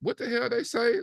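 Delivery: high, confused outburst; baffled viewer. Retold or imitated. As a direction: sound totally lost by the accent. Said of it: "we're listening to it and be like".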